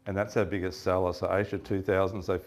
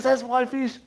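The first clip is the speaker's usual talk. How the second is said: much higher, hammed-up indignant claim; mock possessive claim; 4 dB louder, slower than usual. Mate claiming the fish as his own, hammed-up. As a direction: grab it back like it's yours.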